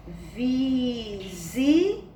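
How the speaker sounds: VISITA very slowly as VI, ZI, TA; syllable by syllable. Shown as a demonstration. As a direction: stretch VI and ZI, pause, then TA. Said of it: "Observaram como foi que a tia leu?".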